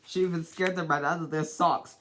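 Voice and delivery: ordinary delivery, nothing marked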